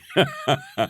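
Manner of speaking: very high, put-on roar of laughter; mocking laugh. A narrator's reading. Cousin laughing at the boy's pineapple bun, hammed up. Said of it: "A roar of laughter".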